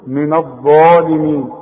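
من الظالمين with ẓaa loud, thick and held; two-count heavy alif. Shown as a demonstration. Quran teacher shows how الظالمين is pronounced, long heavy alif. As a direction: swell and stretch the ẓaa of الظالمين. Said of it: "بنديها حركتين".

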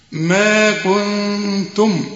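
ما كنتم very slow with nasal غنة; model recitation. Shown as a demonstration. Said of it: "أهو شوف النطق سليم". (ما كنتم بغنة وتلاوة بطيئة)

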